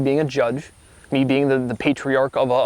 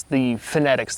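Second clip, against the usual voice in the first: normal talking voice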